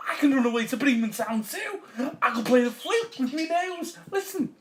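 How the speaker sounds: high, loud eager dog voice; excited talking dog. Acted. Dog boasts to donkey of flute playing, high and loud. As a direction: show off as a keen dog.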